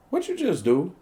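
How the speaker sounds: high, startled question; retold alarm, pitch jumping up. Man hearing the alarm being set, alarmed.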